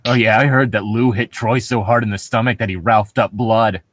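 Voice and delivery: ordinary talk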